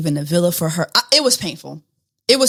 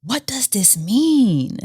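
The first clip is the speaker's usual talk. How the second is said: slow whispered question; slower than usual. Acted. Teasing viewers with a slow mysterious whisper. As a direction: hush it like a mystery.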